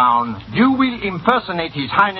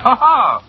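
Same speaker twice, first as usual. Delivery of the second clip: high, loud burst of acted laughter; delighted laugh; 9 semitones higher, 5 dB louder than usual. Boss delighted by the accent.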